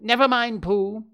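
high, loud interruption; impatient, high-pitched dismissal. A narrator's reading. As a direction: cut in and brush it aside. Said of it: "said Piglet impatiently".

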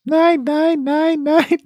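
very high, slow screeching; shrill screeching bird woman. Acted. Bird voice bit.

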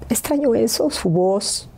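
real grief, voice pushed up high; high, aching voice of someone grieving; choked with grief. Real, not acted. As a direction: miss her with a catch in the voice. Grieving for her late mother.

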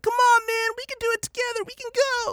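very high, squeaky mock punk kid; pleading little punk. Podcaster imitates little punk at the goodbye, very high mock pleading. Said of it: "this little punk is like".

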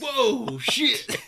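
high, loud shocked outburst; startled yelp. Retold or imitated. Startled recruit reacting to his instructor's outburst, retold as a loud yelp. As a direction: re-enact being jolted by a sudden shout.

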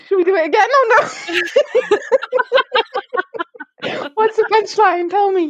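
high, breathless from laughing; after laughing hard. Real, not acted. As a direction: talk while still laughing. Still laughing, high pitched.

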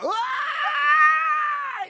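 high, loud mock shriek; imitated scream. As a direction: scream as if shot, imitating a kid. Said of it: "he screams like someone shot him".

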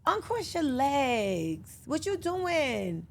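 very slow, drawn-out mom scolding; retold motherly correction. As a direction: stretch each word like a mock-warning mother. Voices mother correcting her daughter.

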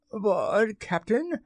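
high, rising, questioning voice; squeaky-high disbelief; bewildered protest. A narrator's reading. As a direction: question the order in a high, puzzled voice. Pirate protests captain's strange order.

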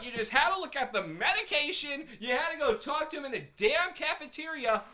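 high-pitched, swooping rant at him; worked-up, indignant dressing-down; exasperated scolding. Real, not acted. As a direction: tell him off in comic outrage. Scolding the character Clark.

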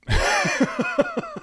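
squeaky burst of laughter; high-pitched laugh. Real, not acted. Laughing at own explanation.

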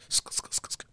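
quick voiceless hissed bursts; imitated skidding noise. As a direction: make short scraping noises with the mouth.

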